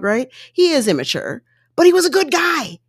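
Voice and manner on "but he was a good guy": higher, punchy emphasis on he; emphatic. Real, not acted. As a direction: stress the word he, a bit higher. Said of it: "first take, higher".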